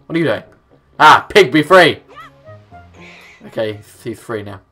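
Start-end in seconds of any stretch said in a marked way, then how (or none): none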